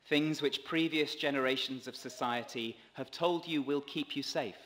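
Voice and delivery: ordinary talk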